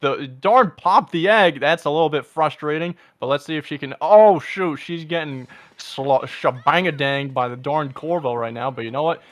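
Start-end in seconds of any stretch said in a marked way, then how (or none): 4.0-9.2 loud, rising play-by-play; excited. Real, not acted. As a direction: call the close fight excitedly. Excited live commentary on boss.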